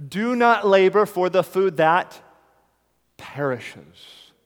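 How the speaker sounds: slow solemn scripture voice; voicing Jesus. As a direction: declare it like the Lord speaking. Quoting Jesus to the crowds.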